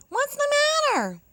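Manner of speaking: high, quick little hare asking; squeaky hare voice, fast and concerned. Acted. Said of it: "asked the hare".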